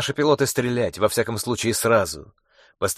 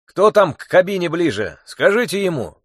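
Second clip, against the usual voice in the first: raised call to the front; shouting; 6 semitones higher, 3 dB louder than usual. A narrator's reading. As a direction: shout over engine noise. Passenger shouting in shaking plane.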